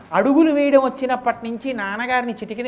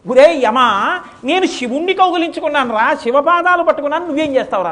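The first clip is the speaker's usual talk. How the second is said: higher, louder, daring challenge; defiant taunt; 6 semitones higher, 7 dB louder than usual. Acted. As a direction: dare him to try, full of confidence. Boy taunts Yama.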